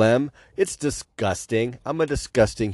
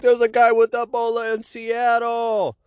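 high, frantic alarmist shout; panicked news voice; 13 semitones higher than usual. Retold or imitated. Mocking the news, a high panicked alarm. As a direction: cry it out like a panicking newscaster. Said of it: "they're like".

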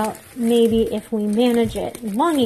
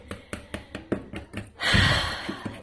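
long, heavy exhaled sigh; real drawn-out sigh; 7 dB quieter than usual. Real, not acted. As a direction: let out a long breath. Vents after forced positive talk.

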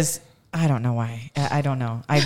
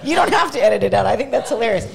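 laughing; 4 semitones higher, 4 dB louder than usual. Real, not acted. Laughs at gum remark.

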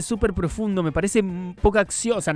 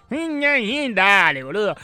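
higher mock of Pattinson's stiff Argentine accent; 4 semitones higher, slower than usual. Quoting the actor's first scene, mocking his Argentine accent. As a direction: ham up his clumsy Argentine lilt. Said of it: "Tampoco podés hacer esa voz".

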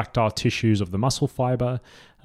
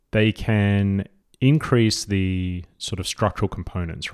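normal talking voice

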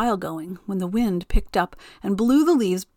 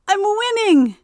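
very high, louder gloating cry; laughing, gleeful Wind; 13 semitones higher, 5 dB louder than usual. A narrator's reading. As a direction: crow over the race. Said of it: "laughed Wind".

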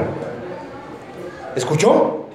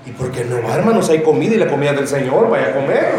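lightly voiced friend; 8 semitones lower than usual. Low-voiced friend urging to go.

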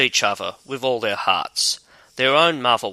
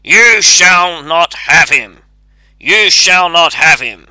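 gruff shout; 4 semitones higher, 11 dB louder than usual. A narrator's reading. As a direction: boom it out gruffly, like a hidden voice. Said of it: "cried in a very gruff voice".

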